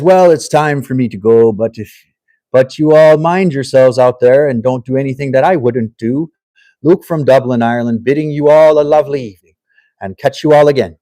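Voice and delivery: loud, hammed-up Irish accent; terrible Irish accent. Host reading out Luke from Dublin's farewell, bad Irish accent. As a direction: put on a bad Irish accent that starts strong and weakens. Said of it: "Sorry for the terrible Irish accent".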